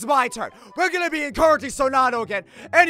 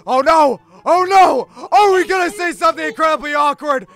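loud panic; 4 semitones higher, 8 dB louder than usual. Acted. Panics as bottle lands.

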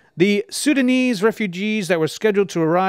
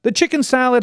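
ordinary talk

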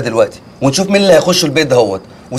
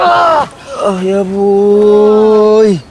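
drawn-out groan; 4 semitones higher, much slower than usual. Acted.

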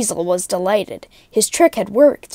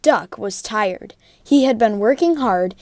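ordinary delivery, nothing marked

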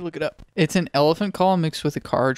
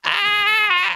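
high-pitched yell; excited scream; 4 dB louder than usual. Real, not acted. Player reacting to the flight, high scream.

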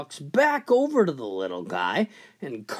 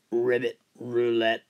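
frog voice; 6 semitones lower than usual. Acted. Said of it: "the frog says".

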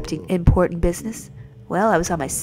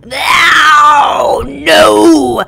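higher, loud shouts; shouting no; 8 semitones higher, 12 dB louder than usual. Acted. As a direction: belt out the refusal. Shouting in character.

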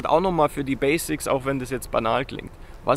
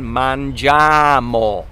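slow demo; 3 semitones lower, 5 dB louder, much slower than usual. Shown as a demonstration. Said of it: "zum Beispiel wir essen".

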